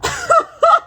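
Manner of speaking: very high, loud laugh. Real, not acted. Woman laughing off her off-topic story, high cackle. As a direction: burst out laughing at your tangent.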